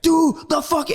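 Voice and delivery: very high mock yell; strained military order, cut off; drill instructor. Drill instructor losing patience with recruit, high mock yell. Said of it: "just start screaming".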